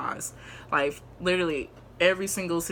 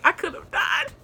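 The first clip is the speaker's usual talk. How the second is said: mock crying; 12 semitones higher than usual. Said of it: "I'm over it crying".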